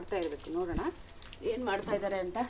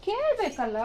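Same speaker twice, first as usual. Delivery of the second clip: much higher, louder playful call; 13 semitones higher, 8 dB louder, slower than usual. Real, not acted. Mother teasing her son to ask her, much higher and louder.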